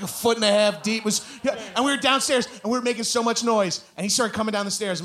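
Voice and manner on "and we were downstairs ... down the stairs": ordinary delivery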